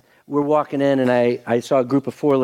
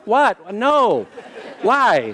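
retold surprise; 10 semitones higher, much slower than usual. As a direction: act out being caught off guard. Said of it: "And I'm like".

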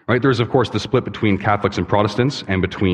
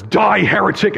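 much higher, louder mock outburst; hammed-up fury; 11 semitones higher, 4 dB louder than usual. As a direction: spit it out as a comic zealot. Joke punchline, old man snaps.

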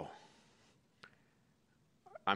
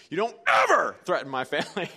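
much louder, higher outburst, EVER yelled; furious shout; 7 semitones higher, 10 dB louder than usual. Retold or imitated. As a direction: explode at the caller who threatened your family. Acts out outburst at threatening caller.

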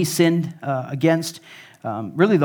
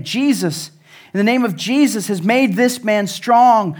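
preaching at full force; 5 semitones higher, 4 dB louder than usual. Acted.

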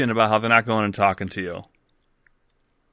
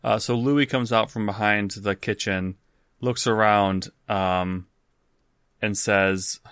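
ordinary talk, nothing marked

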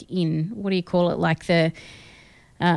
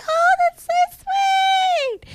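gushing squeal; much slower than usual. Retold or imitated. Mocking herself watching a sweet TikTok, gushing squeal. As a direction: coo over it like it's the cutest thing. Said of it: "And I'm just like".

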